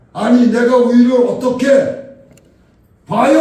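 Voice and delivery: high, very loud protest; indignant shout. Retold or imitated. Dumbfounded man protests he could not look up, indignant shout. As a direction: yell back in disbelief. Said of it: "소리를 친 겁니다".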